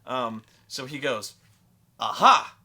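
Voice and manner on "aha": loud, high mock-triumphant aha; triumphant gotcha. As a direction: burst out as if springing a trap. Player springs his ultimate play.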